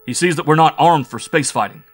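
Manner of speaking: loud, high protest; big man's angry outburst; indignant shouting. A narrator's reading. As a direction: rail forcefully at the enemy's advantage. Bull Mike's angry protest continues.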